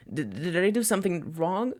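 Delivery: put-on high, stuttering boy's voice; worried stammer. Student asking his silent teacher, high and scared. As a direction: blurt it out, scared of the answer. Student fears he's in trouble.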